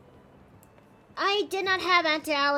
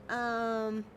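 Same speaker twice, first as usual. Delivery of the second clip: normal talking voice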